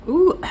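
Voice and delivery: high, loud yelp at the heat; a sharp ooh from spice burn; spicy yelp. Real, not acted. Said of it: "I'm sweating".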